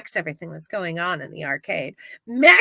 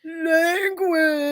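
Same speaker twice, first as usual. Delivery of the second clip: high, softer prissy Language; mock prissy grown-up; 5 semitones higher, 3 dB quieter than usual. Mocking the grown-up who corrects kids, prissy scold. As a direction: scold the kid like a fussy adult. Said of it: "dumbass grown-up saying shit like that".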